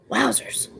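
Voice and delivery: "Wowzers" breathed out in a whisper; hushed whispered "Wowzers". Real, not acted.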